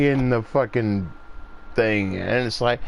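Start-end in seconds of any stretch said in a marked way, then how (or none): none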